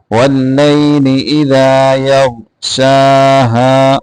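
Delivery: slow, measured tajweed chant; Quran recitation. Shown as a demonstration. Teacher recites the verse for students, slow tajweed chant. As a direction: recite slowly, drawing out the vowels.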